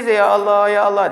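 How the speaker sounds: high, loud retold wail to God; mock crying. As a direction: imitate a tearful plea, lightly hammed-up. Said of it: "nangis aja". (pura-pura menangis memanggil Tuhan)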